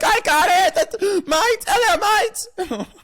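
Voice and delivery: shrieking high-pitched mockery of a gamer; Greek. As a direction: squeal it loud in hammed-up excitement. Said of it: "you sound like fucking".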